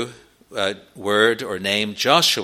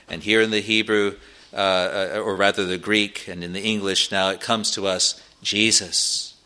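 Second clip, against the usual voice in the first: normal talking voice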